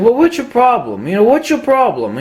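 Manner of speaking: high, pushy "what's your problem"; mock indignant clerk. Adorama clerk snapping back at a picky caller, mock indignant. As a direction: needle the caller like a huffy clerk.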